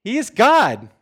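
loud, high jeering call; mocking taunt. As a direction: taunt them like a prophet making fun of their god. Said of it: "Elijah mocked them, saying".

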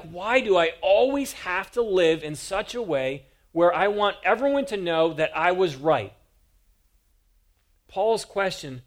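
normal talking voice

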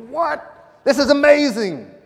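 high, loud mock astonishment; amazed outburst. Preacher imagining himself at the burning bush, high amazed shout. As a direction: shriek it in hammed-up amazement.

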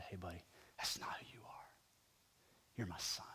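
hushed, tender whisper right in his ear; close whisper. Retold or imitated. Father pulling his son in close, a soft reassuring whisper. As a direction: whisper it softly to comfort him.

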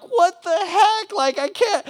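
patient gushing in amazement about his rescuer; amazed excitement. Retold or imitated. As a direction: marvel at the generous stranger. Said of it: "And you're like".

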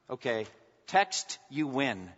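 ordinary talk

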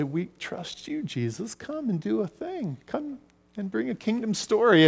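intimate, softly imploring prayer; hushed prayer. Retold or imitated. Retold prayer to Jesus.

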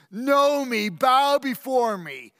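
booming royal command; loud command. Acted. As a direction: order them to bow, loudly. Commanding subjects.

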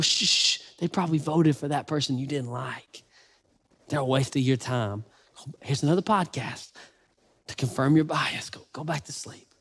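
soft shushing lullaby voice; whispering over a crib. Pretend. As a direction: soothe a baby, ending in a whisper.

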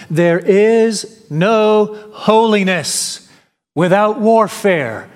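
slow, weighty reading, each word set apart; slow solemn quote. Shown as a demonstration. Preacher reading J C Ryle's words to the church, slow and solemn. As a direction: lay the quote down slowly and heavily. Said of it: "he adds the following".

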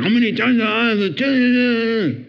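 hammed-up husband losing patience; frustrated husband. Imitating a fed-up husband scolding his insecure wife, rising exasperation. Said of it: "So instead of being frustrated".

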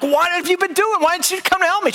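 high, exasperated questioning; indignant. Retold or imitated. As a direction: replay a husband's aggrieved complaint to the wife. Retold quarrel with angry wife.

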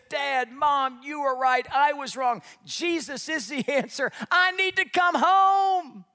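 high, building to a loud drawn-out cry; prodigal child confessing. Acted. Prodigal child coming back to Dad and Mom, impassioned confession. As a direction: plead with rising force, hammed up.

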